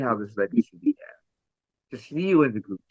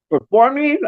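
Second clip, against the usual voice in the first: excited; 13 semitones higher, 6 dB louder than usual. Real, not acted. Excited burst.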